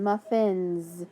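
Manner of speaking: "muffins" with a buzzing z at the end; slow, leaning on the final Z; listen to the Z sound in muffins. Shown as a demonstration. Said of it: "That S makes that Z sound".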